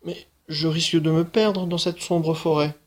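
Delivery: mild protest. Acted. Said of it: "La petite marchande protesta".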